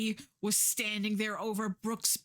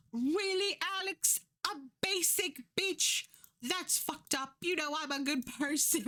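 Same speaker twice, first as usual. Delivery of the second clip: mocking Josie; 8 semitones higher than usual. Voicing Josie's text.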